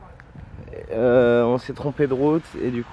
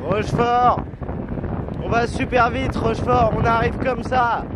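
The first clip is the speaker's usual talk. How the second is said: high, thrilled shouting; excited cheering; 9 semitones higher than usual. Real, not acted. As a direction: cheer it out at full speed. Racing downhill cheering town name.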